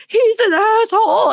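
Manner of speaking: put-on high, loud drunken snarl; loud, shrill heckle from a tipsy woman; drunk outburst. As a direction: spit it at him like a drunk heckler.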